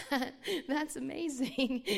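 amazed giggle breaking through; laughing wonder. Real, not acted. Marvelling at God's work to the audience, laughing.

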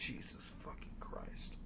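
very soft, voiceless curse; whispered dismay. Real, not acted. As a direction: curse under your breath in disbelief. Dismayed reaction to the script.

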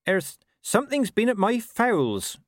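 high, slow farmer voice. Acted. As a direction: report it slowly as a wary farmer.

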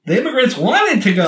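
high-pitched sing-song politician voice; mocking high, lispy impression; Ron DeSantis. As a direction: pitch it way up and lilt. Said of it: "he's the high voice".

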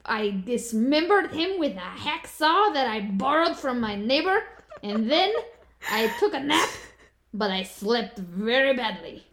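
hammed-up Russian accent, slow and deliberate; mock Russian accent. Shown as a demonstration. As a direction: play a deadpan Russian killer reading her diary in a Russian accent. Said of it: "Can you do a Russian accent?".

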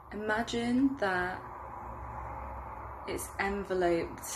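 unhurried meditation guide, growing hushed toward the end; guided meditation. Acted. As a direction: lead them gently through a visualization, softening as it deepens. Leads a guided visualization.

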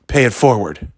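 lightly voiced cashier, even pitch; matter-of-fact parting instruction, understated; cashier's instruction. Cashier handing down advice, lightly voiced instruction. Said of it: "And he's like".

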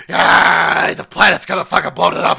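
loud, fast hammed-up panic; loud, fast mock panic. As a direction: shriek it like the end is near.

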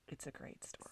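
whispered, breathy repeat; soft whispered aside. Real, not acted. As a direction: whisper it as an afterthought.

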